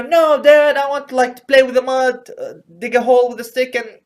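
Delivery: put-on high, loud protesting kid; hammed-up child's protest; pleading son. Acted child pleading with his father.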